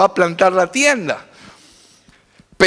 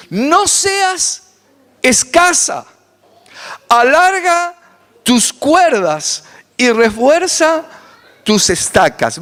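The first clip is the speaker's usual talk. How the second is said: slow, raised, solemn reading of the verse; solemn scripture reading; 4 semitones higher, slower than usual. Acted. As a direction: proclaim the verse slowly, lifting each phrase. Reads Isaiah aloud. (lectura solemne y pausada de la Escritura)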